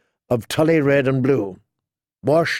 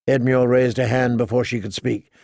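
ordinary delivery, nothing marked

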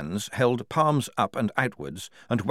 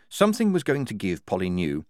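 plain reading voice

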